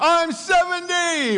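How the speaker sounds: loud, high, drawn-out shout; gleeful high holler; exuberant announcement. Acted. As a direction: proclaim it to the whole world. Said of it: "I'm telling everybody".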